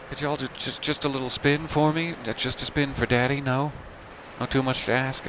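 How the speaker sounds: mock creepy coaxing. Player begging dressed-up companions for a spin, mock creepy coaxing.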